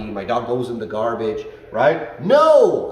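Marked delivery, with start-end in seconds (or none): 2.2-2.9 loud, higher "No" cutting in; firm no. Shown as a demonstration. Trainer correcting the dog, loud firm no. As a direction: stop the dog cold. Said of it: "I identify that behavior".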